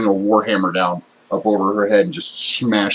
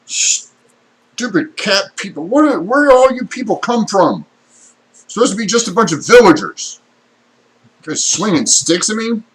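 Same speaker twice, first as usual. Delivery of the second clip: high, indignant bandit yell; 11 semitones higher, 5 dB louder than usual. Acted. Bandit confronts the villagers hitting him with sticks, yelling. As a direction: holler at them, pitched well up. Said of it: "he's yelling".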